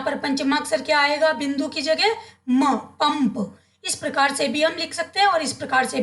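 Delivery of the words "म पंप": म and पंप said very slowly, sounding the म in पंप; teacher modelling पंप slowly; slow pronunciation demo. Shown as a demonstration. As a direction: draw out पंप so the म is heard. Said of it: "उच्चारण करना है".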